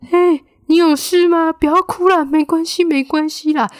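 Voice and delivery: high, loud put-on voice, slowing on the first words; imitating comforting friend. Friend beside her says don't cry, high mock comforting voice. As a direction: play the fussing friend telling her not to cry.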